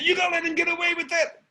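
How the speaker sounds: high, fast taunt; goading kid. Retold or imitated. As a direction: needle the guard to act. Kid goads the guard.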